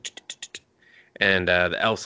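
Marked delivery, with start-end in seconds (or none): 0.0-0.6 soft clicked tut-tuts; tutting. Retold or imitated. Guy tutting under his breath. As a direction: tsk at them quietly. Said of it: "he's like".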